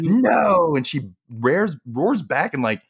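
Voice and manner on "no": loud flat refusal; sharp no. Retold or imitated. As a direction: snap out a high no. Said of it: "she's like".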